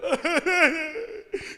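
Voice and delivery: high-pitched fake bawling; mock sobbing. Preacher mimics a wife crying without cause, mock sobbing. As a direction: imitate a wife's exaggerated crying. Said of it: "encuentra a su esposa llorando".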